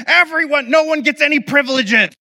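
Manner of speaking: high-pitched yelling, mock household punishment; imitated angry yell. Parent punishing the whole house after a breakage, yelling. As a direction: holler it like a parent laying down punishment. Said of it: "And, like, yell".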